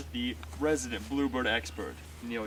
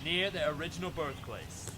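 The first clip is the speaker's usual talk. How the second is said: nature documentary; 3 semitones higher than usual. Acted. Documentary narration over footage.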